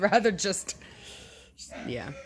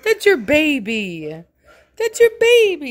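building coax; 9 semitones higher, 8 dB louder than usual. Real, not acted. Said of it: "He's like a".

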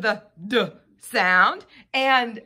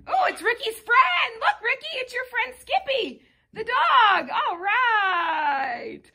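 excited; 11 semitones higher than usual. Real, not acted. Surprise at toy dog friend.

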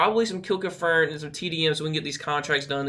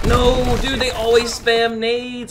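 high, drawn-out cry of annoyance; frustrated protest; 7 semitones higher, 5 dB louder, much slower than usual. Real, not acted. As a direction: complain loudly about a cheap death. Protest at grenade spam.

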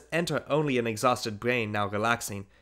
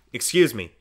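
louder, firm first excuse; stern reproof; 6 dB louder than usual. A narrator's reading. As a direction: push him off, firm. Father stops the child.

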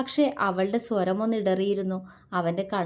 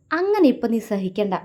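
playful pouting, louder; mock sulk; 3 semitones higher, 6 dB louder than usual. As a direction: pretend to be offended. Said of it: "അവൻ മുഖം വീർപ്പിച്ചു".

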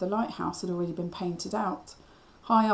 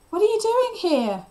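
loud, high-pitched shouted question; alarmed yell; 14 semitones higher, 8 dB louder than usual. A narrator's reading. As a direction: yell it out at someone who shouldn't be there. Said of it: "I yelled".

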